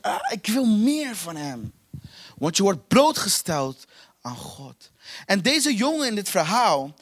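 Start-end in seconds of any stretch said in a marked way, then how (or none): 0.0-1.7 groaned, insistent craving; demanding. Acted. Preacher voices an inner hunger for God, demanding. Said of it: "Er is iets van binnen dat eist".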